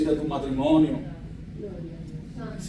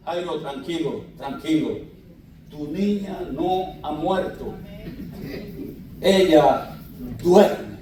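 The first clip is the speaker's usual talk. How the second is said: calm reassurance; 2 semitones lower, slower than usual. Acted. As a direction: soothe a frightened father. Jesús calma a Jairo. (Jesús calma suavemente a Jairo)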